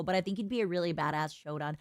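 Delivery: normal talking voice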